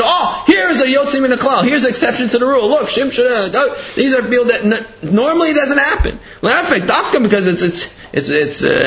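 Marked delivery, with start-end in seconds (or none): 0.0-6.2 high, excited exclaiming; mock announcement. Father explaining the pictures to his son, excited announcing. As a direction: point out the rarity as if astonished.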